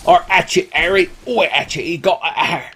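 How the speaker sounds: high Cockney character voice dropping H's; Hagrid. Acted. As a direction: exclaim it in broad H-dropping dialect. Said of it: "It's my Hagrid or Agrid impression".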